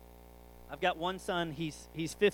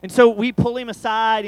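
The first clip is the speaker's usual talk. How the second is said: ordinary talk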